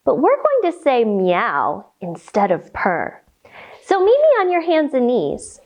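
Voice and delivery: ordinary talk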